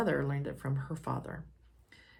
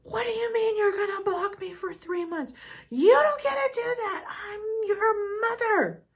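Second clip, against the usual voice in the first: outraged mother; 8 dB louder than usual. Retold or imitated. Mother outraged at being blocked.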